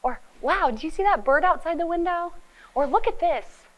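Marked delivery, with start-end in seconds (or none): 0.4-2.3 eager wonder. Shown as a demonstration. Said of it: "bid for attention". 2.9-3.7 eager call. Shown as a demonstration. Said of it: "bid for attention".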